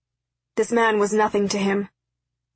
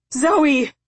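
high, loud desperate call; shouted; 6 semitones higher, 5 dB louder than usual. A narrator's reading. Father calls his departing daughter, shouting. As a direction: shout to her across the distance. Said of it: "her father shouted".

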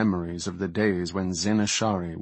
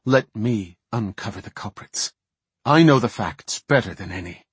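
emphatic plea, building louder; earnest declaration; 3 semitones higher, 6 dB louder than usual. A narrator's reading. Maiev kneels before Tyrande to ask for the task, declaring earnestly. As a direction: declare it firmly, rising in force. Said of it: "bent her head and declared".

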